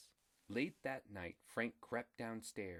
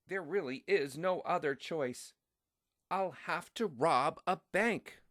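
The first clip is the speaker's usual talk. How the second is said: higher and louder, building to a bold declaration; excited baby resolve; 8 semitones higher, 7 dB louder than usual. A narrator's reading. Baby Frank to himself, deciding to rob a bank, higher and louder. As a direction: announce the plan with growing daring.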